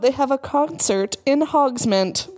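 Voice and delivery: ordinary delivery, nothing marked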